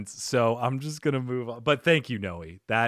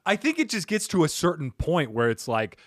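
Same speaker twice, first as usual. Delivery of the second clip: usual speaking voice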